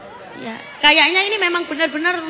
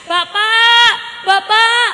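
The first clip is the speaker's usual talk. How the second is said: put-on high, loud child wailing to his father; child complaining to dad, high and whiny; 6 semitones higher, 4 dB louder than usual. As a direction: whine like a hurt kid running home. Child crying home to father.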